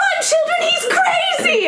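very high, hammed-up cry of alarm; alarmed French woman. French woman warning children about the painter, high mock-panicked cry. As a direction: shriek a comic warning, drawing it out. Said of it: "the French woman's like".

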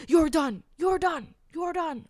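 loud, final, cutting it off; angry repeated shout. Retold or imitated. Stranger at Five Guys shutting her down, loud angry shout. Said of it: "And all he said".

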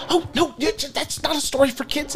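put-on panicked mum hushing the kids; fussing parents. Parents shooing kids away.

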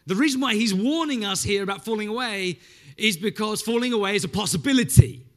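forceful preaching. Real, not acted. Preacher warning the church about falling away, forceful. Preacher driving his point home.

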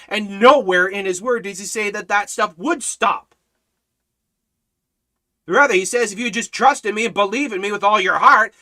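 loud, high, driving sermon delivery; fiery preaching. Acted. Preacher insists miracles still happen, fiery preaching. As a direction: preach it hard to the congregation.

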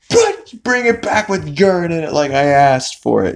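high-pitched indignant yell; hammed-up irate customer; mock angry order. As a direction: send it back in comic fury. Tom angrily orders another coffee.

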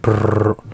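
trilled brrr of a purring cat; imitated cat purr. Shown as a demonstration. Cohost repeats the purr for the host, trilled sound. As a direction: roll out a short purr. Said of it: "I just said".

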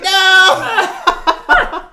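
very high, loud comic refusal; hammed-up shriek of denial; mock horrified yell. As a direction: yell it out, half laughing. Retold horror at talking card.

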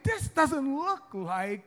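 slow, high, puzzled drawl; mock amazement. Husband comparing wife's cooking to restaurants, mock bafflement. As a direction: sound baffled by what's served. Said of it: "I was amazed".

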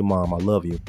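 usual speaking voice

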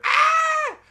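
put-on shrill, loud girl scream; high scream. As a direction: shriek in terror.

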